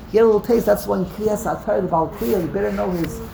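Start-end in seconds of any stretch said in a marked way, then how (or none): none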